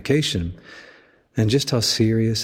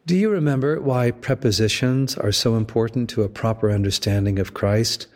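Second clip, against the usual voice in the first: ordinary delivery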